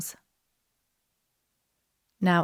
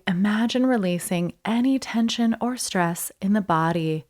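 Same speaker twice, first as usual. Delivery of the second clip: ordinary delivery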